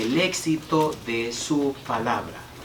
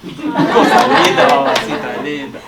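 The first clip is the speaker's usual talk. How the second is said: loud laughing burst, slowed and breathy with delight; joyful laugh; 2 semitones higher, 11 dB louder, slower than usual. Real, not acted. Pastor to congregation, laughing with delight.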